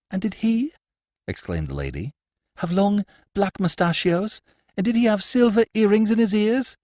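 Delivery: high, urgent noblewoman; excited high lady's voice; agitated lady. Acted. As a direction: press him for news, voice high. The lady questioning.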